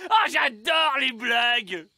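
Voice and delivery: loud high delighted laugh; big laugh. Acted. Gnome loves the trick, laughs delightedly.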